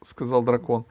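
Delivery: usual speaking voice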